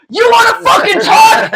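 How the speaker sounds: high, loud challenge; re-enacted furious holler; confrontational shout. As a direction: yell it like you're starting a fight. Retold confrontation with a bat.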